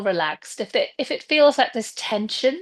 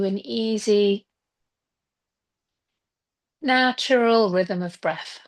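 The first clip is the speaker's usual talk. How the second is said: guided meditation. Acted. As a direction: ease them gently into stillness, leaving space between phrases. Leads a breathing and visualization meditation.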